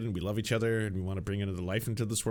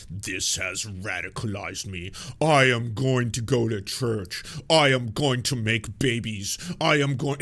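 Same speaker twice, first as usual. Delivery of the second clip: slow, louder, pompous solemn declaration; mocking a grifter; 6 dB louder, slower than usual. Mocking a YouTuber's statement, slow, loud, mock-solemn vow. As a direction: parody a self-serious vow. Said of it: "made a statement where he's like".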